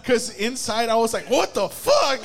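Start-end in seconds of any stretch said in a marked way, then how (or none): 1.3-2.2 high, loud laughing what the fuck; laughing shocked outburst. Retold or imitated. Boyfriend's inner panic at the kid, laughing outburst.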